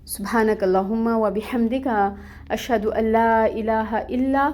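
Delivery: a gently recited Arabic dua; closing prayer. Acted. Teacher closing the class with a recited prayer.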